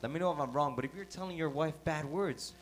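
loud, driving sermon build; fiery preaching. Acted. Pastor challenging husbands in church, loud fiery preaching. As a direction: preach it hard to the crowd. Interpreter matches preaching force.

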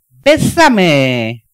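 loud parrot squawk, bésame rising each time. Acted. Parrot from an unchristian home squawking at its new owner, loud. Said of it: "la cotorra constantemente decía".

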